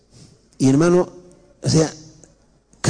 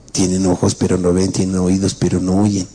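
much lower, solemn recitation of scripture; scripture quote; 9 semitones lower than usual. Real, not acted. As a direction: intone it like a verse from the pulpit. Pastor cites scripture solemnly. (cita bíblica grave y pausada)

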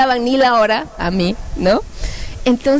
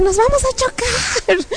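mock panic; 13 semitones higher, slower than usual. Flyers seeing columns straight ahead, mock panic. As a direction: wail as if about to crash. Acting out flight toward columns.

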